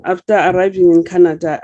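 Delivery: ordinary talk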